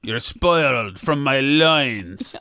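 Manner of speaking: slow, drawn-out mock scold; a lightly voiced wife, slow and scolding. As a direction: drag it out like a nagging wife. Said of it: "like my wife says".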